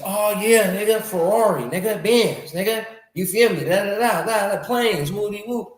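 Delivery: hyped homies imitation. Imitating homies wowed by millionaires' cars and planes, hyped mockery.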